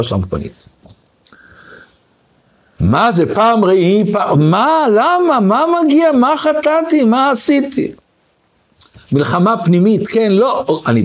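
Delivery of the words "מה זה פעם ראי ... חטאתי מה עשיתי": much higher, pleading why me; anguished protest. Retold or imitated. Congregant protests to God after his car is damaged, high anguished plea. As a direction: cry out to heaven, what did I do.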